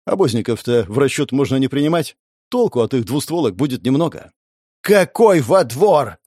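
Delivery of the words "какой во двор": slow, loud exasperated retort; losing patience. A narrator's reading. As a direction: snap it, patience gone. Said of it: "теряя терпение".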